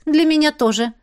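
hurried reply. A narrator's reading. Heroine answers the baron's courtesy, hurried. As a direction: blurt it out hastily. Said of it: "торопливо отозвалась я".

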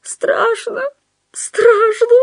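very high, tearful character voice; frightened wail. A narrator's reading. Худяков admits his terror to Остап, high frightened wail.

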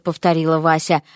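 ordinary delivery, nothing marked

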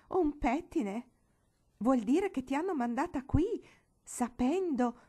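appalled disbelief; shocked outrage. Acted. Aunt Stasia appalled that they sent Marigold here, outraged shock.

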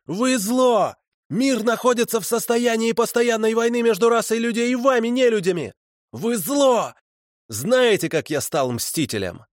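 очень высоко, на крике; кричит, как одержимый обличитель; фанатичная проповедь. Acted. (фанатик выкрикивает обвинения, очень высоко)

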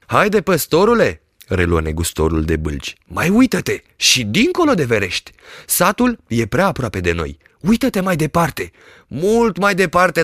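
high, pushing peddler voice; lightly voiced eager merchant; coaxing peddler. A narrator's reading. Peddler urges the shepherd to look farther, coaxing.

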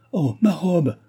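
high-pitched cry of dismay. A narrator's reading. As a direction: cry out, suddenly stricken. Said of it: "s'écria madame du Barry".

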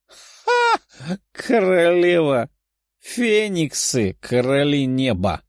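mock the rival's title, then proudly claim it; scornful boast. A narrator's reading. Phoenix princess scoffs at dragon's boast, scornful.

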